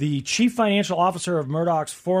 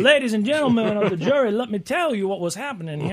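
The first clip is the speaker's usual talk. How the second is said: put-on booming southern lawyer accent; southern accent, playing to the jury; 5 semitones higher than usual. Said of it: "you gotta give that big southern".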